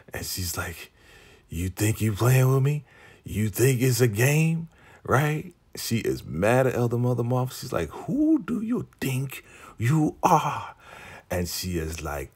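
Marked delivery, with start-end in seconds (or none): 1.5-5.5 higher, challenging taunt; confrontational. Retold or imitated. Ruth confronting Marva. 7.9-10.8 high, drawn-out challenge; slow threat. Retold or imitated. Ruth confronting Marva.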